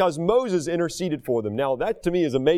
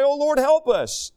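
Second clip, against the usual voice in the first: pleading cry; 8 semitones higher, slower than usual. Retold or imitated. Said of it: "we're gonna cry out to God and we're gonna pray".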